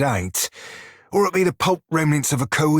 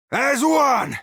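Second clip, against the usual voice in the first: much higher, roared to the crew; full battle roar; 12 semitones higher than usual. Acted. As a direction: top of the lungs, rallying everyone. Battle cry at full strength.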